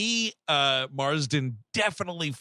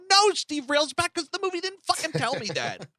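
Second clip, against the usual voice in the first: mock outraged retort; 11 semitones higher than usual. Talking back to Steve Railsback, high mock outrage. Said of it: "And I go".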